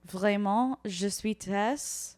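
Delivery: slow, lightly hammed-up French; playful French. Host welcoming listeners in French to amuse her sister. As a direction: speak French slowly like a mock host. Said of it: "speak in French completely".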